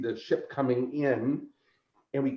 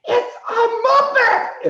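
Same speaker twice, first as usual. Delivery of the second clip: very high, loud, slow delighted scream; woman in the back screaming in delight; 9 dB louder, slower than usual. Retold or imitated. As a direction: shriek it with joy.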